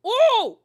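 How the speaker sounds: sudden loud, high ooh; mock-outraged ooh, swooping up. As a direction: let out a big high "ooh". Imagining scolding Alexia over the show.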